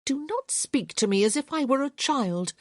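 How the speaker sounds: put-on offended young lady snapping back; indignant. A narrator's reading. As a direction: bristle at being talked down to. Hyacinth indignant.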